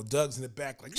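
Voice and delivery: usual speaking voice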